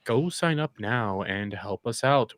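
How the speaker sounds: slow, low mock-pitchman drone; boring sales pitch. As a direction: imitate a tedious coffee plug, dragging it out. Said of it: "'Cause it's so boring".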